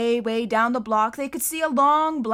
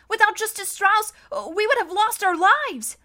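put-on higher, louder young boy arguing; pleading boy; 10 semitones higher, 6 dB louder than usual. A narrator's reading. Klaus arguing with Mister Poe to stay. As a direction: argue earnestly for the judge.

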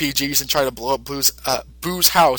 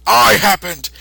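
much higher, louder battle cry; heroic shout; 13 semitones higher, 4 dB louder than usual. Retold or imitated. Mister Satan confronting the sniper, in a much higher heroic shout. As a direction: declare it like a champion. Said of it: "Mister Satan's like".

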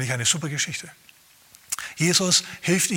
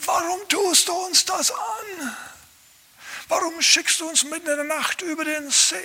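Judas complaining; 9 semitones higher than usual. Acted. Judas crying out at Jesus, high and whining. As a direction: wail it up high like an aggrieved disciple. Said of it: "hätte gerufen".

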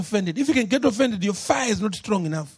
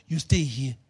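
much lower, a stern low order; low commanding pastor; 10 semitones lower than usual. Acted. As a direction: hold her back with a low stern command. Pastor orders Hannah to stay.

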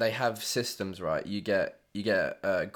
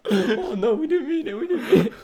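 high, loud fake sob; mock pleading; 14 semitones higher, 8 dB louder than usual. Pupils beg the head of English, hammed-up sobbing. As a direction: whine it like scared kids. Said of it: "We were like".

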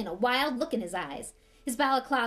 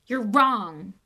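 a sudden, much louder protest; said aloud; 11 dB louder than usual. A narrator's reading. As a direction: blurt it out loud into the silence. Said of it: "he said aloud".

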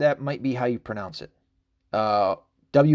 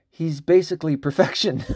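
laughing; 4 semitones higher than usual. Real, not acted. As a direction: quote cracking up with laughter. Laughing while quoting a post.

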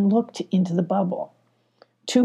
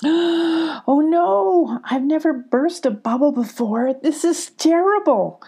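distressed cry; 8 semitones higher, slower than usual. A narrator's reading. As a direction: cry out in dismay at the burst bubble. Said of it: "cried Sparkle".

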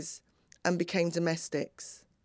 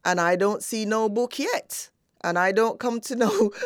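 emphatic Jamaican mother's patois, retold; thick Jamaican accent; 3 semitones higher, 4 dB louder than usual. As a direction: imitate Mum in broad Jamaican. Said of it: "in a very thick Jamaican accent".

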